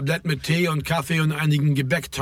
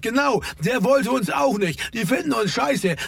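higher-pitched, mildly heated rant; exasperated, worked-up complaint; agitated; 7 semitones higher than usual. A narrator's reading. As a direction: keep arguing, pitched up, without shouting. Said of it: "rief Ferdi erregt".

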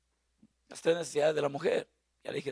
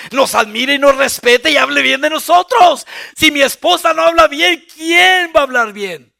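loud, higher preaching climax; preaching shout; 8 semitones higher, 18 dB louder than usual. Real, not acted. Preacher shouting to the congregation about husbands. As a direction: drive it home to the crowd.

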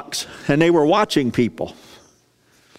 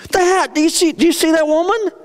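excited Jesus; 3 dB louder than usual. Acted. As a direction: point it out to them, thrilled. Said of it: "He gets animated".

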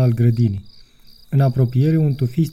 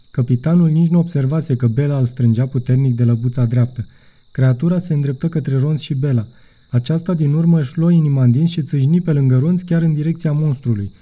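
plain reading voice

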